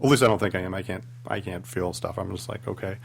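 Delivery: usual speaking voice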